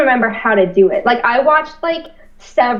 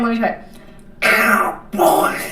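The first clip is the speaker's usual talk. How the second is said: slow, squawky duck voice; Donald Duck voice; 2 semitones lower, much slower than usual. Shown as a demonstration. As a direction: quack the words like Donald. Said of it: "That's Donald Duck".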